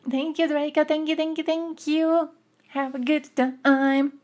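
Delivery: high, sing-song thank you; mock sweet thanks. Thanking a donor in a cooing high voice. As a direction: coo your thanks in a high voice.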